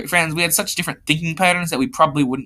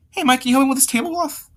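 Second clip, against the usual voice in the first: very high put-on girl's voice; high, odd-sounding request for help; weird voice; 11 semitones higher than usual. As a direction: pitch it way up for an odd character voice. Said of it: "That was a weird voice".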